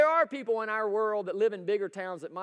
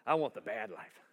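much lower, sulky voice of a contrary listener; mock grumpy holdout; 10 semitones lower, 4 dB quieter than usual. Pastor teasing one congregant with a lower mock voice. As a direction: play the one guy who wants the bad life, lower.

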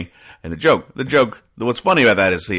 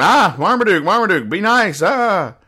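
much higher and louder, calling after the dog; owner calling his dog; 12 semitones higher, 4 dB louder than usual. Retold or imitated. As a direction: call out to your big dog to behave. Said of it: "the guy's going".

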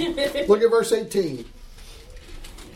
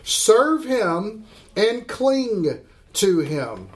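very slow emphasis; much slower than usual. Acted. Preacher slowing to stress verse.

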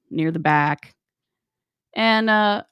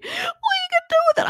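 fast, very high squeal; Lona; much faster than usual. Retold or imitated. Coworker questioning the mirror request, squealing. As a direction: imitate a distraught, squeaky woman. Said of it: "And she's like".

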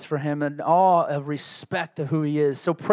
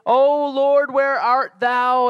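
put-on old-fashioned prayer voice; 7 semitones higher, 4 dB louder, much slower than usual. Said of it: "And I don't speak like that".

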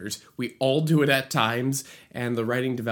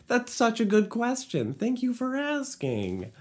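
mock delighted; 11 semitones higher than usual. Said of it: "answers own question mockingly".

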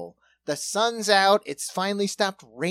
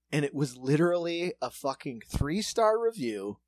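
normal talking voice

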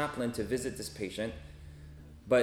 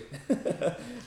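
short laugh; 12 semitones higher than usual. Real, not acted. Laughs at own joke.

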